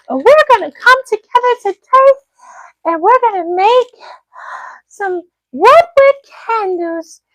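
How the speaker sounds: high, sugary, slow tutorial voice; mock the soap lady's bright YouTube tutorial voice; imitating the soap lady. Mocking a YouTube soap maker's tutorial, high and slow. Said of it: "one time she told us".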